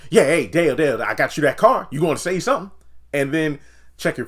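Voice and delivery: hammed-up shout; mock yelling Nancy. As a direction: call him out loudly.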